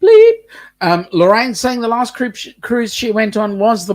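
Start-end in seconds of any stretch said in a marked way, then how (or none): 0.0-0.3 very high, loud bleep; imitated censor bleep. Shown as a demonstration. Bleeping things out on the stream, high imitated beep. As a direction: sound out a piercing censor beep. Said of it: "We can bleep things out".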